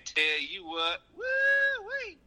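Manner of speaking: hammed-up Southern drawl with high whoop. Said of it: "It's my pizza delivery voice".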